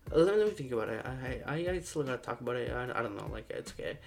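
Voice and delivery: quiet noncommittal mumble; reluctant prospect. Retold or imitated. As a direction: imitate someone wriggling out of a deal. Imitates prospect backing out.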